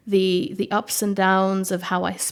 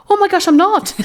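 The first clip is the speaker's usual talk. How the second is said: high, loud exclamation tipping into laughter; mock-shocked reply; 12 semitones higher, 12 dB louder than usual. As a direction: act out your own astonishment, much higher and louder. Her own astonished reply, acted out.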